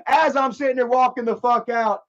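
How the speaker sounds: ordinary talk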